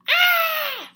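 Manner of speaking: very high loud shriek of fright. Acted. Flap crashing in the dark, shrieking. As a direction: shriek as you fall. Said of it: "shrieked Flap".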